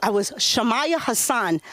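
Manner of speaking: normal talking voice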